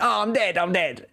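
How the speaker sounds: high, childish fake death cry; mock dying child. Dad retelling son playing dead, high childish voice. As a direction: play dead like a four-year-old.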